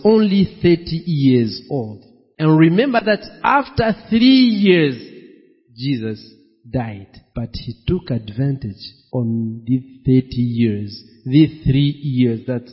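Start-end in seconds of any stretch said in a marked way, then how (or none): none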